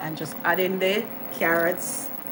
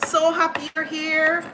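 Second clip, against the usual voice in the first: excited welcome; 8 semitones higher than usual. Real, not acted. Greeting a viewer on live.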